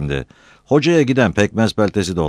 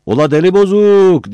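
high, loud call across the village; loud, high taunting yell; shouting; 9 dB louder than usual. Acted. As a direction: holler out with a drawn last word. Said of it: "diye bağırdı birisi".

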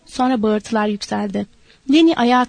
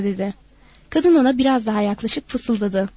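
ordinary delivery, nothing marked

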